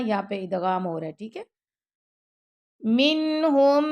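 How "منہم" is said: منہم with a nasal hum held; ghunna. Shown as a demonstration. Teacher demonstrating nasal ghunna. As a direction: hum through the noon of منہم. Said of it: "اب یہاں پہ غنہ بھی کریں گے".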